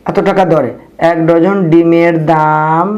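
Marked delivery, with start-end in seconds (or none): 1.1-2.9 slow, measured words with a held ending; drawn-out write-along delivery; dictating while writing. Real, not acted. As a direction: say it slowly as you write. Writing the statement aloud.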